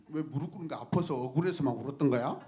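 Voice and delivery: ordinary delivery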